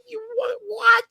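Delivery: very high squeaky disbelief; shocked disbelief. Retold or imitated. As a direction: shriek it in total shock. Shocked reaction retold.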